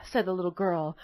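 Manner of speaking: usual speaking voice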